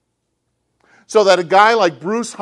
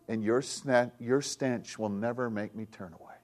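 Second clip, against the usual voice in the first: soft whisper; 4 semitones lower, 7 dB quieter than usual. Acted. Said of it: "God whispers".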